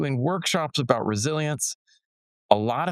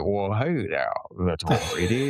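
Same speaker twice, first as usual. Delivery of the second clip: put-on John Wayne, Korean accent; 4 semitones lower than usual. Friend mimicking a Korean schoolmate playing John Wayne, Korean-accented impression. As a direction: imitate a Korean-accented cowboy star. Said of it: "he had this thick Korean accent".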